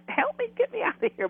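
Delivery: high, hurried begging; imitated urgent plea. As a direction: rush it out like someone trapped, mimicked. Voices Patrick begging to be rescued.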